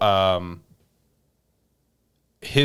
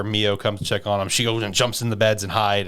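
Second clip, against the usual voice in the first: usual speaking voice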